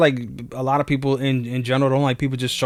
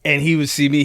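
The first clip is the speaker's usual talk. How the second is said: ordinary talk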